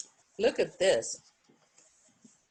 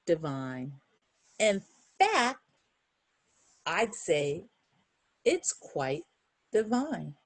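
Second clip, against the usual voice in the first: slow, lilting picture-book read; reading to children; slower than usual. Acted. Reader to kids, closing the book, slow sing-song read. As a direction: savour each word of the refrain. Reads the refrain slowly.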